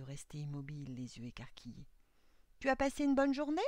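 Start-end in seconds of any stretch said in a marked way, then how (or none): 2.6-3.6 high, loud, quick chatty question; old man. A narrator's reading. Old man bustling in the kitchen asks his doll, high quick voice. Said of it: "demanda le vieil homme".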